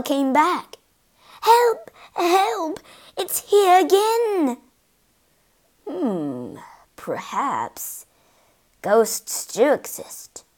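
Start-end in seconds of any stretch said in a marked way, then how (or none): 1.4-4.6 high, alarmed wail; panicked cry for help. Acted. Alarmed at the returning bloodstain. 5.9-10.4 musing doubt. Acted. Mister Otis starts to doubt.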